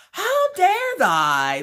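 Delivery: very high, loud, drawn-out mock outrage; old-fashioned melodrama. Wife left behind by husband and son, shrieking melodrama. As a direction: wail it like an affronted period heroine. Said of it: "talking like you're from the nineteen hundreds".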